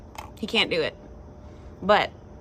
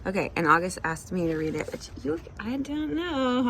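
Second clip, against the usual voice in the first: ordinary delivery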